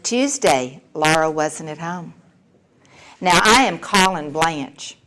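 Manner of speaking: normal talking voice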